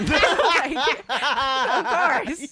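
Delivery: laughing through the words. Real, not acted. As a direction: burst out laughing. Laughing at her own story.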